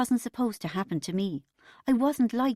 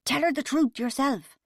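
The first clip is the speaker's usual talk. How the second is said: raised, sharp hissed retort; tense, higher snap; 7 semitones higher, 4 dB louder than usual. A narrator's reading. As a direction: throw it back at your husband in a hiss. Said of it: "Then Mum hissed".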